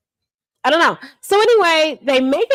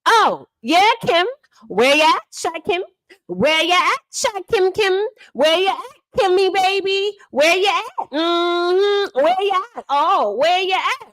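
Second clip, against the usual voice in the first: higher singsong where you at; singsong teasing call; 6 semitones higher than usual. Retold or imitated. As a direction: tease Kim in a calling singsong. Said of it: "Honest Roe says".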